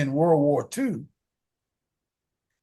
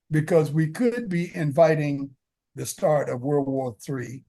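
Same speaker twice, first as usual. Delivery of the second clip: ordinary talk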